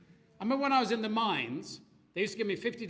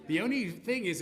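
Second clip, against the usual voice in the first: normal talking voice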